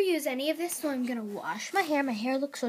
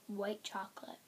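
whisper; 4 semitones lower, 14 dB quieter than usual. Real, not acted. Whispered aside over product.

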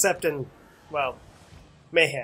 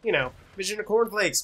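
normal talking voice

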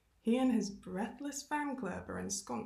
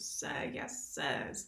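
mock military response, lower at the end; hint of parade-ground obedience; saluting soldiers' chorus; 5 semitones lower, much slower than usual. A narrator's reading. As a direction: lightly imitate rigid cadets answering an officer. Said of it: "I imagine rigid salutes and a chorus of".

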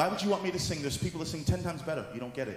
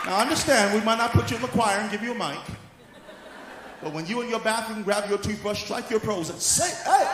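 high, loud sermon delivery; fired-up preaching; 9 semitones higher, 7 dB louder than usual. Real, not acted. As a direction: urge the congregation on, building to a shout. Preaches at full force.

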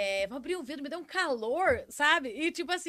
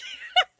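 high, loud laugh at herself; quick real laugh; burst of laughter; 9 dB louder than usual. Real, not acted. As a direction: laugh out at the memory. Laughs at own story.

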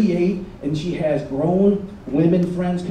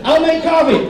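mock eager; 8 semitones higher, 4 dB louder than usual. Acts flustered offer of support.

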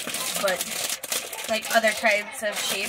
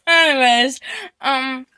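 usual speaking voice

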